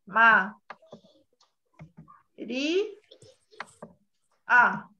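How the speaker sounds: "Ma. Ri. A." split with long pauses; syllable by syllable. Shown as a demonstration. Teacher splits the word for students, syllable by syllable. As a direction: spell out "María" one syllable at a time. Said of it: "vocal acentuada de María?".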